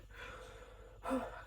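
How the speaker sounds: soft, long exhale; real sigh, welling with feeling; emotional sigh. Real, not acted. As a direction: sigh quietly to collect yourself. Getting emotional about the book.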